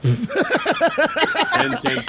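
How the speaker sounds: high, very slow laughing drawl; hammed-up voice. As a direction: stretch it out in a silly high voice.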